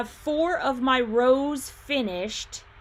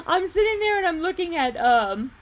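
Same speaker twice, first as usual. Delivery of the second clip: laughing; 10 semitones higher, 3 dB louder than usual. Real, not acted. Cracking up reading a chat comment.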